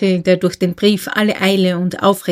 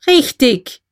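higher-pitched, falling outburst; exclaimed shout; 5 semitones higher, 3 dB louder than usual. A narrator's reading. Uncle agrees with nephew, in a sharp cry. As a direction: burst out with it, short of a full shout. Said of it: "schrie der Onkel".

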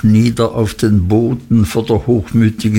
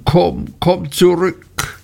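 verzweifeltes Rufen; 8 semitones higher, slower than usual. A narrator's reading. Emly ruft verzweifelt Martha.

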